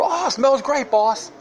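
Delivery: high mock-servant voice; servile peasant. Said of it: "be house and field peasants".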